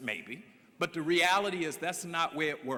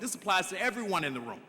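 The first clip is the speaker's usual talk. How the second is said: usual speaking voice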